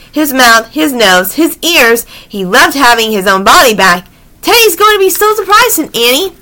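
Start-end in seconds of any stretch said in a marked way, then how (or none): 4.4-5.7 put-on high, eager girl's line; mild excitement. A narrator's reading. Annie looks forward to surprising Teddy, high and mildly excited. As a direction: say it pitched up, pleased with the feat. Annie delighted after changing back.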